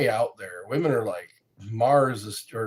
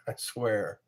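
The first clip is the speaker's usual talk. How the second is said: ordinary talk, nothing marked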